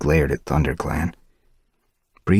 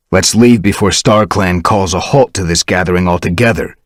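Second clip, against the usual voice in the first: louder stern command; 2 semitones higher, 7 dB louder than usual. Acted. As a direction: cut the gathering short. Firestar angry.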